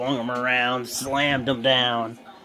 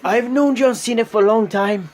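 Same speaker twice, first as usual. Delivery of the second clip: high, louder put-on Mexican accent; terrible Mexican accent; 9 semitones higher, 4 dB louder than usual. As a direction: keep the bad Mexican accent going. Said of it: "terrible Mexican accent".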